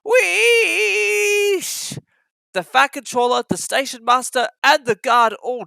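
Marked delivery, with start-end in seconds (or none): 0.0-2.0 a high, sharp burst of steam; steam hiss. Acted. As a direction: let off steam like an engine. James lets off steam.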